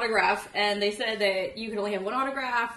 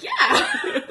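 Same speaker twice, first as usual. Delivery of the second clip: very high, loud fangirl squeak; retold giddy squeal; starstruck squeal; 9 dB louder than usual. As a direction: squeal it at your idol with a laugh. Starstruck fan answering her idol.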